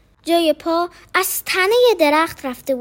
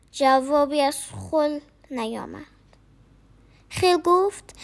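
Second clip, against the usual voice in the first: ordinary delivery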